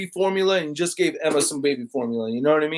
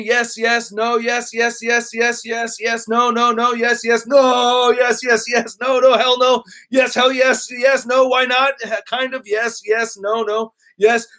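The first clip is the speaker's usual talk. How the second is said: rattling off votes, slightly raised pitch; rapid-fire reading; 4 semitones higher than usual. Real, not acted. Reading chat's votes as fast as possible, rapid-fire. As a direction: race through the list without stopping. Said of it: "I'm trying to read it as fast as I can".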